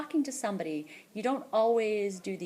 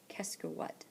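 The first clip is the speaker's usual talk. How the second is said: breathy whispered gloss; whispered aside; 9 dB quieter, slower than usual. Real, not acted. Teacher whispering the meaning to the class.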